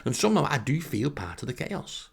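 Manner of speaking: usual speaking voice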